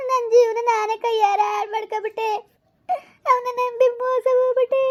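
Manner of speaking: high-pitched, drawn-out wailing sobs; crying. Acted. Woman laments trusting him and ruining her life, crying. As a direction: wail it out through tears. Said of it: "ಸುಮ್ನಿರು, ಸುಮ್ನಿರು ಮಗ".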